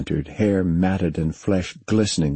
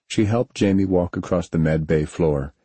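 usual speaking voice

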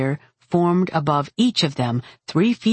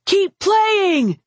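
high, cheerful call over the din; upbeat shout; 4 dB louder than usual. A narrator's reading. Mage urging bagpipers on mid-battle, upbeat high call. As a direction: cheer the players on. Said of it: "his voice upbeat".

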